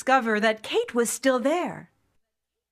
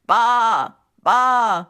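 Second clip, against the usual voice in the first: loud sheep bleating instead of words; 7 dB louder than usual. A narrator's reading. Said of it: "came out of her mouth".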